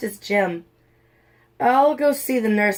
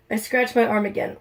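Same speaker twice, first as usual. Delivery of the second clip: ordinary delivery, nothing marked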